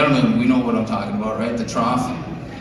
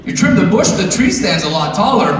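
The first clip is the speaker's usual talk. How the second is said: chatty Chad; 6 semitones higher than usual. Retold or imitated. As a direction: play the overfriendly bathroom bro. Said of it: "some chatty Chad would come up".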